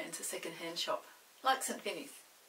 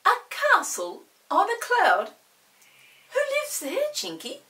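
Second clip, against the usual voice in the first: much higher and louder, astonished; amazed question; 14 dB louder than usual. Acted. Peter asking Chinky about the cloud castle, high and amazed. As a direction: ask in wonder. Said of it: "said Peter in amazement".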